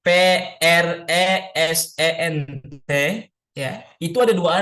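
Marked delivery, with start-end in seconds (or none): 0.1-2.9 letters R E S E N T spelled one by one. Shown as a demonstration. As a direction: spell present for the class.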